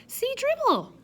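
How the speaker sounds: put-on high, loud toddler voice; eager little kid showing off his book; small boy shows his old book to the guests, high and loud; toddler Fudge. A narrator's reading.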